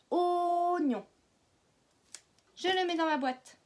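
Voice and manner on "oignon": oignon with a long held first O; stressed opening O. Shown as a demonstration. Teacher showing O at the very start, opening vowel held. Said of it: "au tout début".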